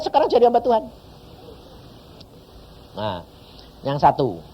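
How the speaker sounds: ordinary talk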